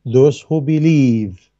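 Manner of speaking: "Those who believe" drawn out slowly, every word weighted; slow emphasis. Shown as a demonstration. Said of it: "do you see the first one?".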